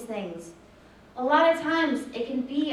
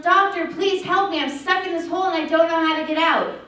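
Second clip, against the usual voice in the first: higher, louder desperate call; yelling for help; 4 semitones higher, 7 dB louder than usual. Retold or imitated. Boy in the hole shouts up to the passing doctor, loud and pleading. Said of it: "and yells".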